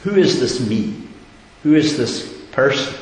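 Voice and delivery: normal talking voice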